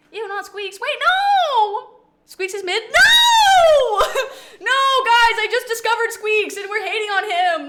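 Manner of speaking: high laughing protest; goofy. Real, not acted. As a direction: shriek no at chat playfully. Protesting chat, laughing shout.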